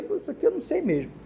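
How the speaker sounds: unprepared candidate waving the topic away, high and fast; mock lazy student. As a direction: toss it off like a student giving up. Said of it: "O pessoal despreparado já vê isso aí".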